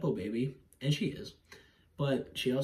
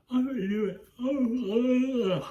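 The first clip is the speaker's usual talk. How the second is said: mock despair; 11 semitones higher, 5 dB louder, slower than usual. Mock despair at own stumble.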